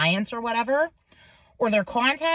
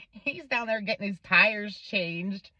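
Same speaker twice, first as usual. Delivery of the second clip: ordinary talk, nothing marked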